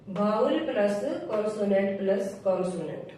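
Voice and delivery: ordinary delivery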